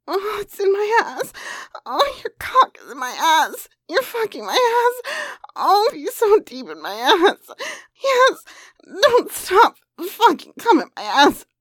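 loud breathless moaning; moaning loudly. Acted. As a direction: moan the words out, building. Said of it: "I moaned over and over".

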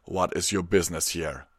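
loud, firm demand; stern questioning. A narrator's reading. Guard captain questioning a restrained stranger, loud and firm. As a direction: question the intruder firmly.

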